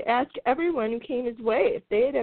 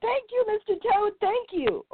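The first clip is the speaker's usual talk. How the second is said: high hurried thank-you called back; shouted thanks; 13 semitones higher than usual. Acted. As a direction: call it out while rushing off. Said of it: "he shouted".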